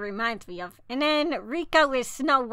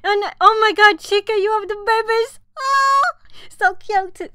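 higher and louder, gushing; excited squeal; 7 semitones higher, 7 dB louder than usual. Real, not acted. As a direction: squeal over how cute it is. Seeing Chica's card art.

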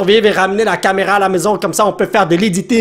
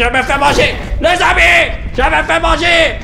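panicked cry for help; 7 semitones higher than usual. Real, not acted. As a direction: call out while being eaten. Being eaten, calls friends. (appel au secours paniqué)

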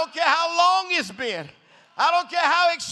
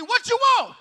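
loud, high, blunt "What you want?"; Jesus asking bluntly; 9 semitones higher, 3 dB louder than usual. Retold or imitated. Preacher voicing Jesus in his own way of talking, loud blunt ask. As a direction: fire it off like a blunt question. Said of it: "if you talk like me".

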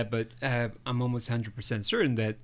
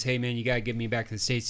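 rushed plea; 3 semitones lower, 5 dB quieter, faster than usual. Retold or imitated. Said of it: "She goes".

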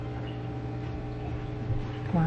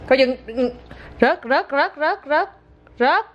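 high, rising repeated word; rapid little alarm calls, each rising; urgent warning; 5 semitones higher, slower than usual. Real, not acted. Mother warning toddler it will drop, high repeated warning.

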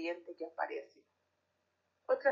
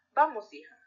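father voice; 4 semitones higher, 6 dB louder than usual. Acted. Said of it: "los cambios de voces que hice".

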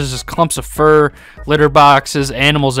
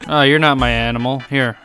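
ordinary talk, nothing marked

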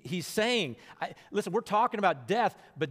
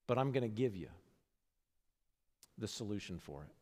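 quoting Jesus; 4 semitones lower than usual. Retold or imitated. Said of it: "what he does do is".

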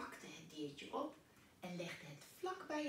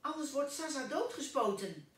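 urgent, higher and louder call; 8 semitones higher, 9 dB louder than usual. Acted. Mr. Pen urgently shouts at Pluk to hurry. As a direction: hurry the boy off at full voice. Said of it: "en riep".

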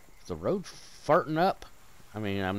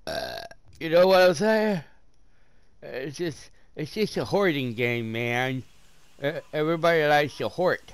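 lightly put-on goofy voice, a bit higher and louder; 5 semitones higher, 6 dB louder than usual. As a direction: play a silly character voice. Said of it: "I don't know why I'm talking like this".

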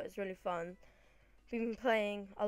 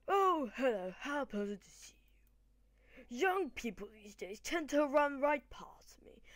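put-on higher, swooping posh old-man British accent; posh elderly British man; 3 semitones higher than usual. Old man greeting a young challenger before battle, posh British voice. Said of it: "I did my old man British accent".

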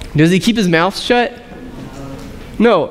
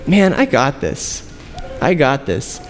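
smug inner voice; 5 semitones lower, 4 dB quieter, slower than usual. Retold or imitated. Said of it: "in my head saying".

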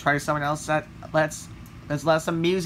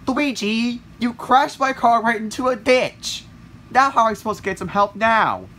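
higher-pitched rant after the crash; angry scolding; 5 semitones higher, 4 dB louder than usual. Acted. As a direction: scold the driver, exasperated.

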